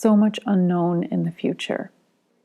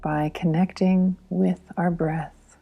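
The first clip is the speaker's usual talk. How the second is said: guided meditation. Real, not acted. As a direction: lead a breathing exercise gently and unhurriedly, phrase by phrase.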